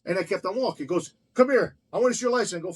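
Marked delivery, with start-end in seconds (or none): 1.3-2.6 higher, louder called-out command; imitating officer's order. Officer stopping the restaurant owner, imitated commanding call. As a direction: call after someone walking away.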